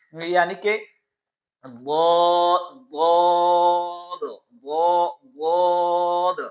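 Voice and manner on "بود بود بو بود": بود drawn out slowly, heavy and even; correct daad drill. Shown as a demonstration. Teacher drilling the correct daad. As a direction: repeat بود slowly, lips still. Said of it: "یہ ہے طریقہ بود کا".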